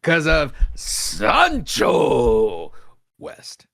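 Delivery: drawn-out, very slow boast; slow shouted boast. Real, not acted. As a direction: stretch it out in a shout. Boasting about saving the pod.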